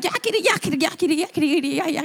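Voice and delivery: half-hearted creature jabber; ugly squeaky creature. Retold or imitated. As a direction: rattle off a mock critter chatter. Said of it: "I don't sound as ugly as that creature".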